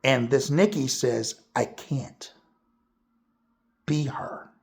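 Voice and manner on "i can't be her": soft whispered confession. Retold or imitated. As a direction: breathe it out without voice. Retelling Nikki's sad reply.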